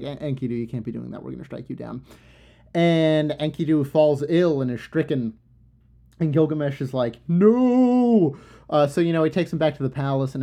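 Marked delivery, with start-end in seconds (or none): 0.0-2.0 softer, hushed group verdict; gods' verdict. Retold or imitated. The assembled gods pronounce Enkidu's doom, hushed. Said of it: "They were like". 7.3-8.4 high, loud anguished no; anguished cry. Retold or imitated. Gilgamesh cries out as his friend falls ill, anguished. Gilgamesh sees Enkidu stricken.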